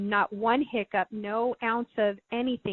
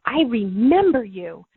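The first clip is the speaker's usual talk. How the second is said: louder and slower, leaning into remember; tearful outburst; 9 dB louder, slower than usual. Retold or imitated. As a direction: let it swell as the tears break through. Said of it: "I started crying, going".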